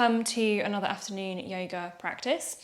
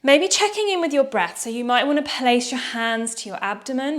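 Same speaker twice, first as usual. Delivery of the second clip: ordinary talk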